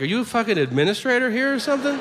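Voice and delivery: a student gawking at you; incredulous student. Retold or imitated. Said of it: "they'd be like".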